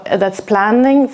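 normal talking voice

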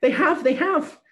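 put-on high, loud delighted child; excited little girl. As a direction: show off the bird with joy. Excited child shows the bird.

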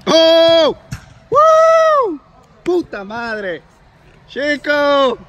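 near-goal shouts, high and loud; breakneck goal commentary. Real, not acted. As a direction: yell at the almost-goal, then rush the words.

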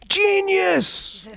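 a huge high scream of delight; the chairman screaming genius across the room. Retold or imitated. Chairman screaming out at the new name, ecstatic high yell. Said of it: "is screamed out so loud".

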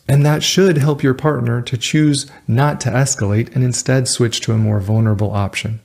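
normal talking voice

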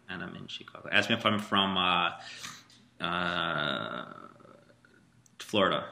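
usual speaking voice

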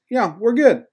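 high-pitched, breezy reassurance; nonchalant batter imitation. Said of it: "and just says".

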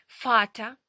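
"Фата" loud, starting on a crisp f; German V as F. Shown as a demonstration. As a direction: model "Фата" with f, rising at the end. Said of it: "вона читатиметься як звук Ф".

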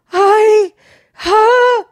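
too scared to get the words out; breathless gasp. Retold or imitated. Wife waking her husband, breathless panicky gasp. Wife panting, can't speak.

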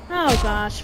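usual speaking voice

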